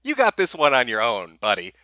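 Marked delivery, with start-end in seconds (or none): none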